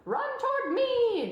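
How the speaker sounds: high, loud, slow gander call; yelling gander. A narrator's reading. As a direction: yell it like a gander. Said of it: "yelled the gander".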